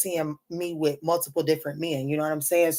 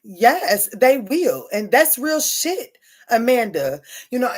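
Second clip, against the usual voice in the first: ordinary talk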